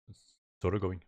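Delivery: usual speaking voice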